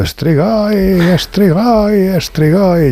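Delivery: high-pitched chanted cry, drawn out; imitated ritual cry. Retelling a witch's frenzied ritual to listeners, imitated high cry.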